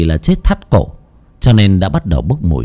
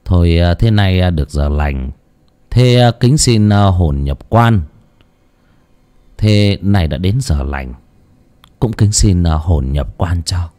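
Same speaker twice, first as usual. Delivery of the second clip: slow, low ritual invocation; solemn coaxing; 3 semitones lower than usual. A narrator's reading. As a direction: coax the soul into the coffin, measured and drawn out. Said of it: "miệng khuyên bảo". (khấn khuyên hồn, chậm rãi, trang nghiêm)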